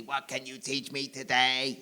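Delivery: slow, soft robot monotone; measured, quiet android question. Acted. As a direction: ask it like a curious robot. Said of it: "I talk and act like a robot".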